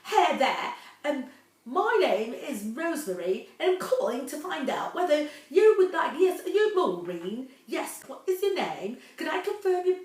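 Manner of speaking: lightly put-on thick Cockney caller; mocking a scam caller in a hint of Cockney; mock Cockney accent. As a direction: imitate a pushy British caller in a hammed-up Cockney. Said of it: "they're using a very thick British accent".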